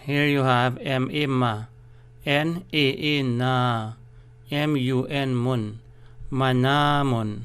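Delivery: M A A ma drawn out slowly; teacher's slow spelling; slow spelling drill. Shown as a demonstration. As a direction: spell each syllable slowly, stretching the long a. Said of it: "there must be a stress".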